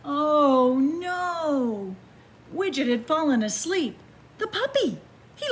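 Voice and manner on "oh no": ordinary delivery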